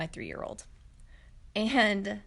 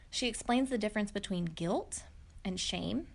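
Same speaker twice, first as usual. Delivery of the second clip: usual speaking voice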